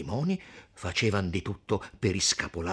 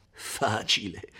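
incredulous echo; slower than usual. Acted. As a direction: echo "Facile" in disbelief, drawn out and falling. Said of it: "dissero insieme quei due". ("Facile" ripetuto incredulo, allungato e calante)

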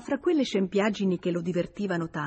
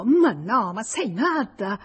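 surprised stammer; 3 semitones higher, 6 dB louder, slower than usual. Acted. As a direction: caught off guard, louder on "Ma no". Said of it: "Lui, sorpreso, balbettò". (balbettio sorpreso e colto in fallo)

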